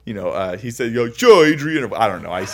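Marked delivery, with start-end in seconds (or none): 0.9-1.9 badly faked Rocky voice, louder; mock Rocky Balboa drawl; a hint of Rocky, hammed-up; Stallone impression. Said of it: "I can't do it".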